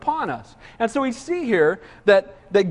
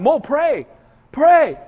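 punchy demand, louder on the repeat; urgent mock plea; 4 semitones higher, 3 dB louder, much slower than usual. People begging Moses as fire comes, urgent mock plea. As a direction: urge him on in pretend alarm.

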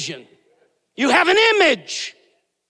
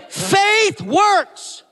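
call to repeat; 6 semitones higher than usual. Acted. Everybody say.

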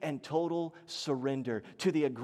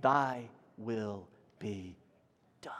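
very slow, low and soft prayer; reverent recitation; 11 semitones lower, 5 dB quieter, slower than usual. Acted. Preacher finishes the Lord's Prayer with church, slow and reverent. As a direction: let each word settle, hushed and low.

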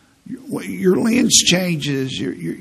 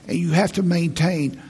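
ordinary talk

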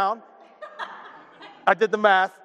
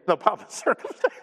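"circumstance" cracked by a laugh; giggling fix of the phrase; laughing correction; 2 semitones higher than usual. Real, not acted. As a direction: correct yourself while laughing.

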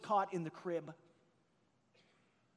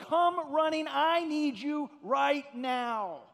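higher and louder, the last words slowed way down; baby's desperate yell; 5 semitones higher, 4 dB louder, much slower than usual. Retold or imitated. Pastor voicing a baby crying for a parent, desperate higher yell. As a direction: call out like a baby needing help right now. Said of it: "it is a yell that says".

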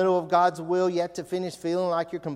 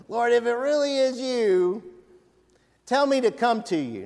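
higher timid Peter voice; hesitant disciple asking to come; Peter voiced; 7 semitones higher, 3 dB louder than usual. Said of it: "Peter says".

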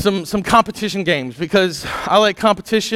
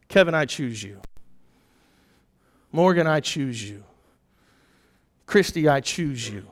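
tender quiet; 6 semitones lower, 7 dB quieter than usual. Pretend. God's voice choosing each person.

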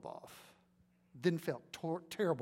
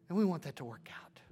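fast breathy whisper to God; whispered prayer; faster than usual. Acted. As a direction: whisper the plea intimately.

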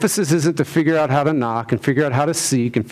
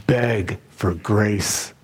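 slow stress; 3 semitones lower, much slower than usual. Acted. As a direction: beg for grace, drawn out very slowly. Preacher stresses the key phrase.

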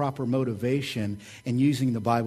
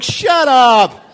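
higher, louder, mock-irritated outburst; mock snapping; 10 semitones higher, 13 dB louder than usual. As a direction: snap at the satnav in jest. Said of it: "I'm like".

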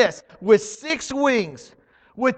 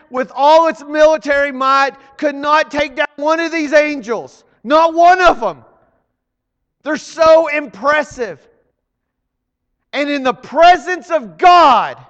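ordinary talk, nothing marked

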